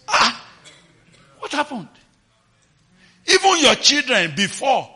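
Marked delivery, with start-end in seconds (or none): none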